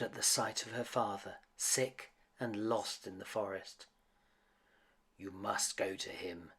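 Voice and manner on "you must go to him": a hint of sadness, softer; quietly sorrowful, understated; sadly. A narrator's reading. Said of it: "said the beast sadly".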